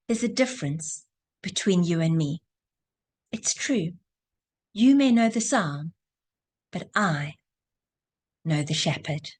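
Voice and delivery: put-on low, gentle, knowing old man; smiling old man. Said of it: "the old man smiles and he says".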